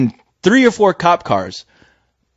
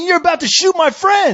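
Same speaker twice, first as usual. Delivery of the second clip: high-pitched, agitated retold protest; indignant accusation; 13 semitones higher than usual. Retold arguing back at officer.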